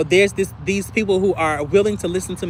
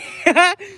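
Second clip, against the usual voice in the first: quick, high real laugh; laughing at her own act; 11 semitones higher than usual. Real, not acted. Laughs after fake fine.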